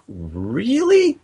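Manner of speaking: high, drawn-out disbelieving really; retelling his reaction to a friend's confession with high incredulous surprise. Retold or imitated. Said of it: "I'm like".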